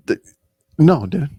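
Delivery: ordinary talk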